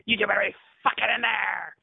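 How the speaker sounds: loud, high-pitched yell; mock outraged bellow; retold angry shout. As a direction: holler it at someone behind a door. Retold shout of man outside.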